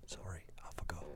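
hushed whispered apology. Pretend. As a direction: whisper it sheepishly. Told not too loud, whispers back.

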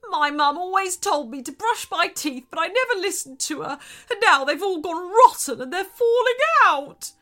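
high, loud, building lament; wailing. Acted. Shark lamenting rotten teeth, loud wail. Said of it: "wailed the shark".

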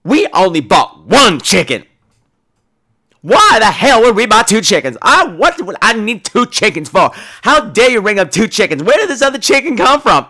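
playing the outraged thief, hammed up; woman at checkout fakes outrage over two chickens, loud and indignant; indignant outburst.

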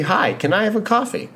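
high-pitched mock customer request; lightly acted, high-voiced coffee order.

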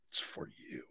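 hushed, whispered handover; barely audible, reverent mock offering. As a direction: whisper it like presenting a precious gift.

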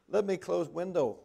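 mock phone lady. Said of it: "she said".

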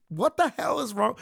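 high, exasperated yell; angry outburst. Real, not acted. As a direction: berate him at full tilt. Angry outburst at the actor's comments.

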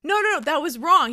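imitating an insistent referee; firm rejection. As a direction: cut in with a noisy objection. Brother-in-law rejecting the answer.

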